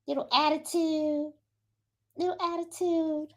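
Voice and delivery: high, soft singsong teasing; teasing pet talk. Real, not acted. Teases the dog.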